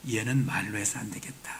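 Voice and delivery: whispered, as if to himself; whispered aside. Acted. God whispering to himself that words won't work on Jonah. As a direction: mutter under his breath in resignation. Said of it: "그래서 하나님이".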